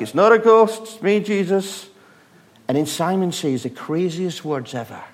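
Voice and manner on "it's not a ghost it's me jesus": loud, higher call across the water. Retold or imitated. Jesus calling across the lake to scared disciples, loud. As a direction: shout it over the waves. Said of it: "a voice comes back".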